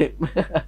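soft wheezy chuckling that runs on; laughing fit. Real, not acted. Player mistaken for a doctor, soft helpless laugh. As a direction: crack up quietly after speaking.

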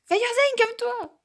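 real shrill yell; shrieked order. Real, not acted. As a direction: shriek at her to calm down. Yelling at another player.

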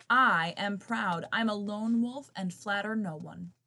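ordinary delivery

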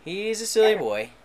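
high, loud doting voice; fond teasing. Real, not acted. Owner talking about his belching dog, high doting tease.

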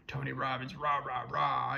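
a hint of a stage motivator pumping up the crowd; half-hearted rally chant, building a little; imitating a hype speaker lightly; mock hype man. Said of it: "that's how you, like, pump up the crowd".

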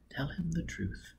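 breathy whispered lines; feed him the line in a whisper; whispered prompt. Acted. Robin whispering the witty answer to Foyle.

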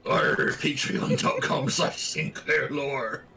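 slow, hearty pirate growl. Pretend. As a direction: ham it up like a pirate captain. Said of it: "Okay, pirate voice".

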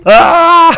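high, loud scream of a fall; real falling-off scream. Real, not acted. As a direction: let out a full yell.

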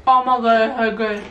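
speaking between crunchy bites; talking while chewing. Real, not acted. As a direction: mouth partly full of chips. Seen 0: Speaker unclear; both chew chips, man holds chip near mouth throughout.

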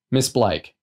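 mildly blunt official address; understated brusque officer. A narrator's reading. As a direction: cut in formally. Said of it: "said bluntly".

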